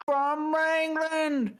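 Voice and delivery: high, slow cowboy Texan drawl; hammed-up Texan accent; bad Texan accent. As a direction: ham up a Texan twang. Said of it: "I'll try one of them, uh, uh, Wrangling accents".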